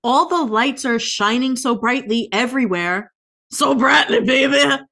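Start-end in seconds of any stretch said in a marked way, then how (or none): none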